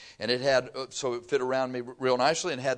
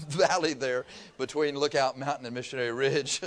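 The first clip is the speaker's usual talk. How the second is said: ordinary talk, nothing marked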